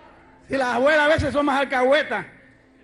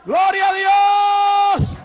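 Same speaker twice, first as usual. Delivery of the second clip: loud, high, very slow shout of praise; 7 semitones higher, 6 dB louder, much slower than usual. Real, not acted. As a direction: cry it out to the rafters.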